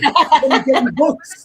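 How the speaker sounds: high, loud, laughing question; laughing disbelief. Retold or imitated.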